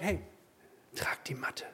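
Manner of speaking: a hushed, conspiratorial order; whispering Jesus's command to the healed man; whispered command. Acted. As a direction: whisper it, soft.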